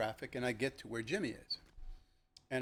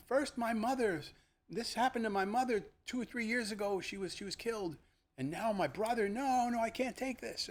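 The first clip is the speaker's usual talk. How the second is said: high, strained grieving voice; anguished; 9 semitones higher than usual. Retold or imitated. Officer learning of his brother's death, anguished and pitched high. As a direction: plead as if it's all too much.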